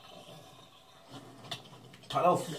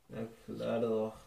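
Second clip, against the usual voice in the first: ordinary talk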